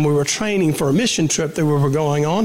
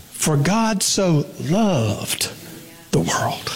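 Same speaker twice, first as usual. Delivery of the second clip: "loved" stretched long, then a pause; stress on LOVED; much slower than usual. Shown as a demonstration. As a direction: draw out "loved" slowly and let it hang. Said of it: "emphasis on a different word".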